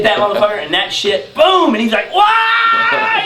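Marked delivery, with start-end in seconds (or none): 2.1-3.2 high, loud shocked yelp; yelp of pain. Retold or imitated. Fat kid shot with a dart, high yelp. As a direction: squeal it out as it hits.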